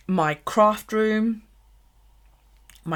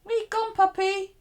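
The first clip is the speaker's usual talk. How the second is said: higher, sing-song call to a pet; calling the dog; 13 semitones higher than usual. Real, not acted. Owner calling for her missing dog, sing-song. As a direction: coax the puppy back.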